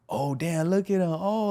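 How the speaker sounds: high-pitched mock amazement, imitating joking kids; mock jeering. Kids joking at the church team's bad basketball, mocking amazement.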